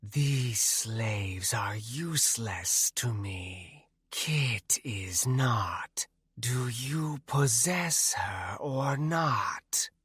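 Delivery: slow, breathy ghostly whisper; wraith whisper. A narrator's reading. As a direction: hush it out like a shadow wraith. Nanaya as wraith, held voice.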